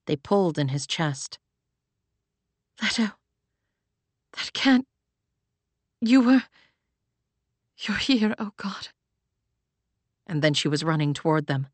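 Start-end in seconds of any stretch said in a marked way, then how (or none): 2.8-8.9 halting, vulnerable disbelief; broken, overwhelmed words. A narrator's reading. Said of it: "so vulnerable".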